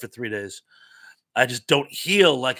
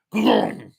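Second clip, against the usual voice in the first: imitated rumble; 6 semitones higher, 5 dB louder than usual. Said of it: "everything just kinda went".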